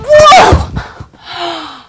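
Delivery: playful high whoa mid-jump; excited whoa. Pretend. Teacher jumping into the garden, playful whoa. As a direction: whoop as you land.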